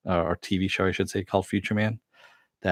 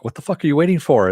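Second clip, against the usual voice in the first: higher, louder, quick mock outburst; impatient retold urging; 8 semitones higher, 5 dB louder, faster than usual. Teammate urging the entity to enter, mock exasperation.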